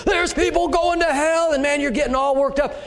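high, loud revival-preacher swell; fired-up preaching. Retold or imitated. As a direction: whip the crowd up about hell.

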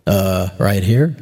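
mildly comic voice of God answering; God's reply. Pretend. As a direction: drop deep and calmly say you're right there. God answering beside you.

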